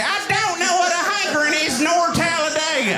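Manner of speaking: high, hammed-up South Carolina accent; put-on Southern accent. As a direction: wail it out as a baffled Southerner. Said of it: "never been to South Carolina before".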